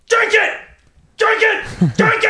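very high, loud repeated mock command; gunman yelling. Gunman pointing shotgun at clerk, hammed-up shouting. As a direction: shout each one like a crazed robber. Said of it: "sticks the shotgun in her face and says".

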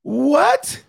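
high, rising stunned "What?"; disbelieving squeak of a question; shocked what. Real, not acted. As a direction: caught off guard by the clip, high and incredulous. Stunned by the clip.

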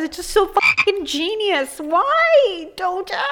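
high, wailing fan despair; hammed-up heartbroken whine; mock lament. Mock lament over favorite singer.

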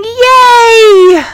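high, loud, drawn-out cheer; cheering shout. A narrator's reading. Kids hear the end-of-day bell, shouting joyfully. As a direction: cheer like a class let out.

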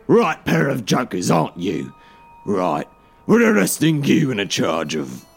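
mocking copper in character; jeering. A narrator's reading. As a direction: sneer at them while making the arrest. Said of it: "jeered Anderson".

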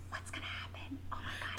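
hushed, anxious whisper; suspenseful whisper. Retold or imitated. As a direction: whisper it as if the page might hear. Retelling her suspense while reading.

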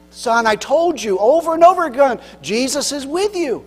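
a father hollering up the stairs, fed up; dad shouts up to his son, exasperated. Retold or imitated. Dad answers again, fed up.

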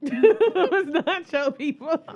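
loud, high, drawn-out through laughter; laughing disbelief. Real, not acted. Host rejects guest's Creole people claim, laughing in disbelief. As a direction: crack up while objecting.

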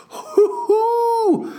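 whooping cheer. Retold or imitated. Cheering after the hype.

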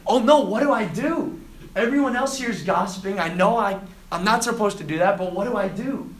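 loud, high acted panic easing off; mock fretting, starting at full alarm; freaking out. Student among gossiping roommates, freaking out. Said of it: "you start freaking out".

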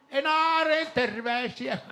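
a loud, slow, high shout of greetings. Shown as a demonstration. Radio host shouts greetings north to Inari. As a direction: call out far to Inari. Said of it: "Nyt mä huuan täältä".

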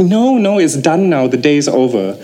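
high-pitched protest, sliding down; protesting refusal. Retold or imitated. Saleh resisting his friend's dare, protesting. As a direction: refuse in mock alarm, voice up.

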